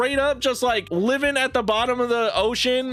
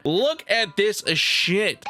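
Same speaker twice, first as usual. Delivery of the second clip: ordinary delivery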